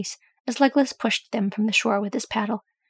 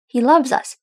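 staunch; 4 semitones higher, 7 dB louder than usual. A narrator's reading. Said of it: "Thorin said staunchly".

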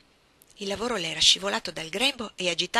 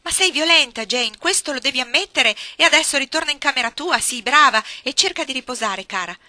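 voce più alta e forte, tono ansioso da zia; signora Reed turbata; 6 semitones higher, 12 dB louder than usual. A narrator's reading. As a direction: cerca di calmare la bambina, allarmata. (zia spaventata che tenta di calmare Jane, agitazione)

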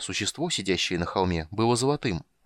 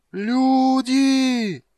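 long, high, full-voiced cry; alarm shout; 8 dB louder than usual. Shoemaker runs up to warn the party, screaming. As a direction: yell far out to raise the alarm.

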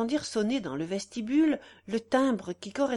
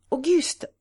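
curt call; 6 semitones higher, 5 dB louder than usual. A narrator's reading. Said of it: "dit-elle d'une voix brève".

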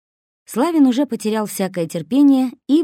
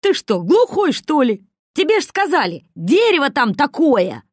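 much higher and louder, exasperated character voice; exasperated yelling; 9 semitones higher, 6 dB louder than usual. A narrator's reading. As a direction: yell at him like he's deaf. Kosoy berates the driver. (раздражённый крик, выше и громче)

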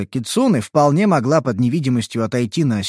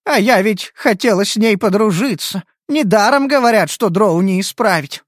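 disappointed; 6 semitones higher than usual. Acted. Disappointed illithid.

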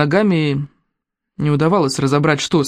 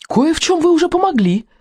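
high, strained, testy hero; irritated hiss; 11 semitones higher than usual. A narrator's reading. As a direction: hiss it high-pitched and indignant. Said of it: "прошипел подвешенный вверх тормашками герой". (раздражённо шипит высоким голосом)

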